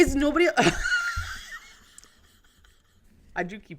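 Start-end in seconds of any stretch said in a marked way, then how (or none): 0.5-1.6 high squealing laughter. Real, not acted. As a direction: burst into a shrill cackle. Laughing burst.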